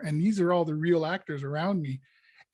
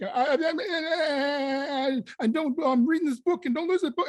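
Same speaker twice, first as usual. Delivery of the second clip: much higher, rapid frantic babble; 10 semitones higher than usual. Retold or imitated. Prisoner in his cell, frantic high-pitched babble. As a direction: spin out in jittery alarm. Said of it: "I'm all frantic".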